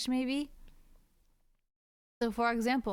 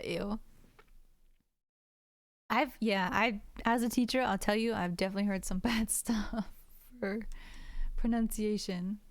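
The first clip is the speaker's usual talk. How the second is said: normal talking voice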